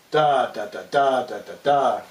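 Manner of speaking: long stressed "da", then two short ones; "da, da, da" chanted long-short-short; accented meter. Shown as a demonstration. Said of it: "we could do this with, with this".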